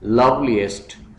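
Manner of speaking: loveliest slowly in beats, love-li-est; split into syllables. Shown as a demonstration. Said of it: "syllables में divide करना है".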